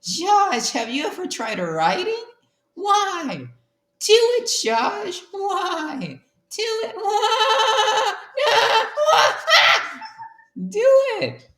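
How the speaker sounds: very high laughing yells; high manic shouting. Acted. Freddy urges Josh to write, very high manic yells. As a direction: scream it in a hammed-up frenzy.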